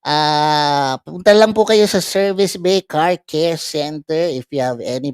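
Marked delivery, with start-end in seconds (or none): none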